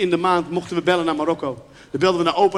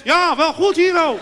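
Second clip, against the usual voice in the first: much higher, loud yell; father yelling into the phone to grandma, much higher and louder; father shouting down the phone; 8 semitones higher, 5 dB louder, slower than usual. Retold or imitated. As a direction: shout as if Morocco were far away. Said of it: "hoor je wel eens uit de flat".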